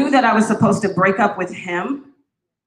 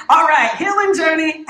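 high, loud playful call; excited announcement; 8 semitones higher, 4 dB louder than usual. Real, not acted. To the congregation, loud upbeat announcement. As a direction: hype the new topic.

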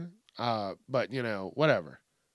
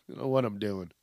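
ordinary delivery

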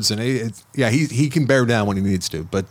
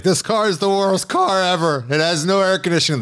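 put-on kid complaining; 6 semitones higher than usual. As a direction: mimic a grumpy overheated boy. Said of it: "And he's like".

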